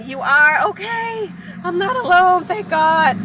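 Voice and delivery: overwhelmed thanks, voice rising; emotional relief. Real, not acted. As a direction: let the gratitude burst out. Relieved reading supportive comments.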